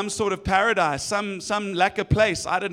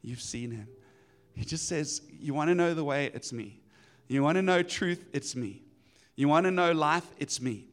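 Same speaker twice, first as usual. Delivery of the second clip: calm assurance; 6 semitones lower than usual. Retold or imitated. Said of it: "he said".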